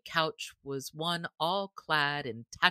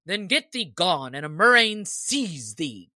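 mildly angry sheriff, a bit louder; a hint of shaking rage; trembling anger; 3 semitones higher, 7 dB louder than usual. A narrator's reading. Sheriff cursing the stranger who refused him, mildly angry. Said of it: "his voice trembled with anger".

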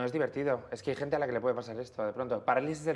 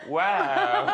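high, louder laughing "wow"; mock wow; 12 semitones higher, 6 dB louder than usual. Shown as a demonstration. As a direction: send up a fake amazed reaction. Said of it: "aprovecharás para hacer".

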